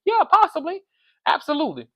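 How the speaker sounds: high sing-song yeah, then dropping to absolutely; knowing sass. Real, not acted. Vlogger conceding the cast schemed, with a high sassy yeah. Sly agreement about scheming.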